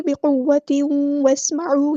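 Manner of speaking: बिकुव्वतिन वस्मऊ chanted slowly with nasal merge; slow recitation. Shown as a demonstration. Teacher demonstrating idgham to students, slow. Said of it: "यहाँ पर इदगाम नाकिस हो रहा है".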